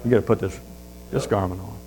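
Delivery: low and hushed, gently firm; the host quietly turning away the overdressed guest; quiet correction. Acted. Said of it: "And the guy goes".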